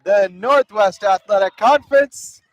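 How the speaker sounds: loud, rising, slowed-down final call; excited game-winning call. Acted. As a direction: shout the last out with rising excitement. Game-ending out called.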